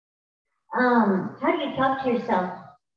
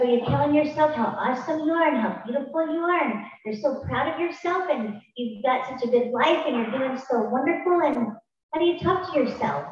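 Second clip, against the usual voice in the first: ordinary talk, nothing marked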